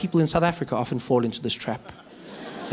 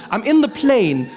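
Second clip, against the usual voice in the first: normal talking voice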